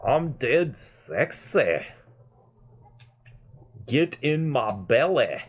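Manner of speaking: high, slow, hammed-up Scottish brogue; Fat Bastard. Player repeats a favourite Austin Powers quote, slow high Scottish accent. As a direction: relish each word in a comic Scottish accent. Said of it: "the way Mike Myers says it".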